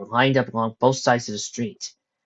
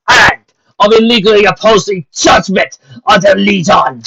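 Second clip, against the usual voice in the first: stern captain; 10 semitones higher, 13 dB louder than usual. A narrator's reading. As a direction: lay down the law to the crowd. Captain pronounces sentence.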